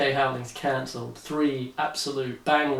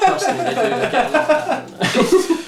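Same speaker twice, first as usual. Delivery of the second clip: laughing; 12 semitones higher, 8 dB louder than usual. Acted. Laughing reassurance, much higher.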